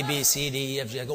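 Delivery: ordinary talk, nothing marked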